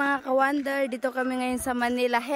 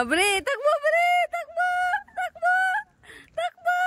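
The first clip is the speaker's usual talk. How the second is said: calling out. Real, not acted. Urging the dog to run.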